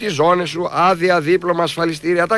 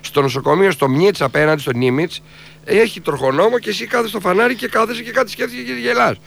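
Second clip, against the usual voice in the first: usual speaking voice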